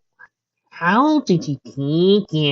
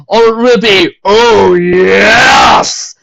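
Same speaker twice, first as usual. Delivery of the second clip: loud slow build to a shout; 7 semitones higher, 10 dB louder, much slower than usual. Acted. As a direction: belt the answer out. Proposal climax, shouted.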